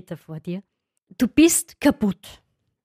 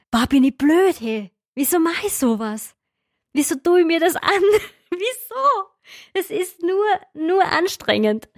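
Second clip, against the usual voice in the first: higher, rising to a near-cry on why; groaning at herself mid-race, voice climbing; exasperated self-talk; 7 semitones higher than usual. Retold or imitated. Runner scolding herself mid-marathon, exasperated and higher. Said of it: "Und diese Gedanken".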